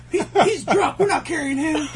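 high voice through laughter; high laughing buddies. Retold or imitated. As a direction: squeak it out laughing.

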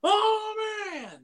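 "Oh man" shouted very high, cracking; fake-thrilled cry over something lame; mock excited yell. Son mocking the lame flight packs, a high shouted "Oh man". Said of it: "He goes".

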